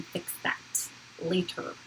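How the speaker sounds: soft half-whispered voice; whispery voice. Real, not acted. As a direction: talk in a hushed whisper. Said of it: "Why am I talking like this".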